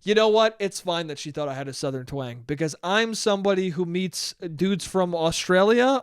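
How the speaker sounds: normal talking voice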